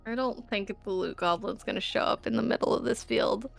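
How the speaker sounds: ordinary talk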